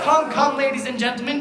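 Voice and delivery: loud, high call to the crowd; rally the audience; loud coaxing. Acted. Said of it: "we boo and hiss the villain".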